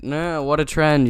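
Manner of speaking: ordinary talk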